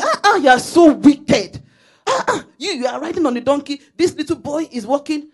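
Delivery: shrieking tut-tutting crowd, hammed up; scandalised villagers. Villagers scolding the father for leaving the boy walking, loud mock outrage.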